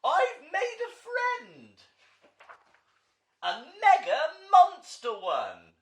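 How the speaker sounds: very high, loud, slow delighted cry with a laugh; cheering. Acted. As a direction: burst out with joy. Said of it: "Monty cheered".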